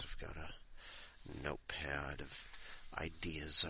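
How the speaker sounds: soft whisper. Real, not acted. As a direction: whisper it quietly. Whispered aside.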